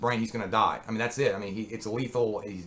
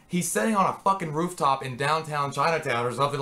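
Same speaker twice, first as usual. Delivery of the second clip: ordinary talk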